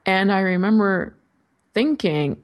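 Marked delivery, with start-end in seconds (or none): none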